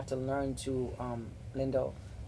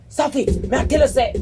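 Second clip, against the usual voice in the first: imitating husband yelling, much higher and louder; mock yell; 10 semitones higher, 11 dB louder than usual. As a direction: throw the husband's heated line at her. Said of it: "I feel like you're yelling at me".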